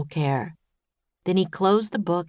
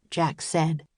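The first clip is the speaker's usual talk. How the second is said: ordinary delivery, nothing marked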